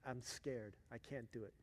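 very soft, small retold refusal; hushed, mildly fearful little voice; scared child. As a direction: imitate a timid child, barely voiced. Daughter refusing, frightened.

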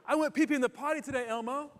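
put-on high, quick little-kid voice; imitating young son. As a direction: report it proudly like a potty-training toddler. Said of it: "he'd say".

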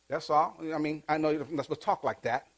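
ordinary talk

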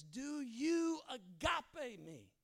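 high, slow Jesus asking; slow earnest question. Retold or imitated. Jesus asking Peter by the fire, slow earnest question. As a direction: ask it slow and searching.

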